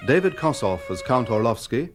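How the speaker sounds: ordinary talk, nothing marked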